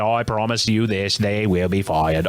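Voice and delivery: put-on Kennedy accent, slowing toward the end; mock Kennedy accent. Host promises listeners the writers will be fired, mock Kennedy accent. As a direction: make a solemn vow in a put-on Kennedy accent. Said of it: "this is my Kennedy accent".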